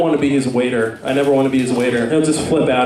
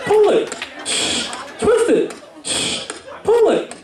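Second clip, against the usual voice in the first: Bop It toy voice; 13 semitones higher than usual. Pretend. The comedian voicing the Bop It toy for the crowd, high commands. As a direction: bark the toy's orders way up high.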